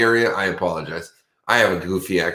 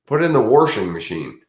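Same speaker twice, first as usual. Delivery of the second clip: raised, mock Michigan "warsher"; hammed-up Michigan accent, pitched up at first; 5 semitones higher than usual. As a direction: imitate a Michigan accent with an r in wash. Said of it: "the Michigan, where instead of wash".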